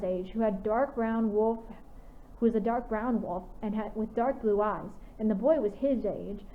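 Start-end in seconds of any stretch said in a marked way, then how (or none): none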